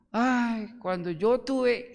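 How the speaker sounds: mock someone living in the past; nostalgic whine. Pastor imitates people bragging about old times, whining. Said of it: "gente que habla así".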